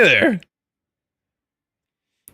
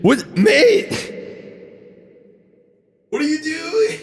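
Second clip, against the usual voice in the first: stifled shriek; 14 semitones higher than usual. Real, not acted. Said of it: "I'm trying not to scream any louder".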